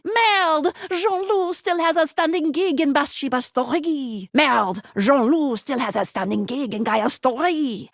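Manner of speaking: put-on exclamation, then a French-accented game line. Game narrator reporting on Jean-Loup's gigs, French accent.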